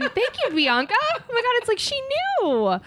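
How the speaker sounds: mock gushing thanks. To Bianca, for the perfect cue, a high mock-delighted squeal. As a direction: gush over the perfect cue like a game-show host.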